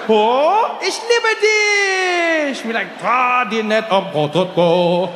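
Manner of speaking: high, drawn-out mock German joy; hammed-up gushing in German, stretching the words; delighted surprise. Dad imagined opening the door to his son, overjoyed. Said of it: "he was gonna open it, be surprised".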